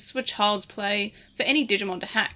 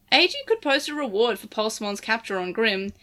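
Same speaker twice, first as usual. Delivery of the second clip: ordinary delivery, nothing marked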